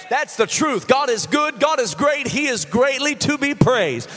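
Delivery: usual speaking voice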